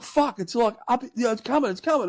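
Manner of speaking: high-pitched, urgent retold warning. Passenger warning the driver it's coming, acted urgent. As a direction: imitate a mate urgently calling it out. Mate's panicked warning while driving.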